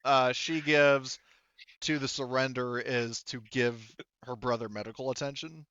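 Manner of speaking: ordinary talk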